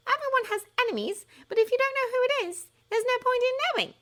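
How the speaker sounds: put-on high, brisk know-it-all girl; a high brainy girl brushing off the prophecy; impatient schoolgirl. A narrator's reading. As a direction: lay it out like a sharp schoolgirl. Hermione's voice, impatient.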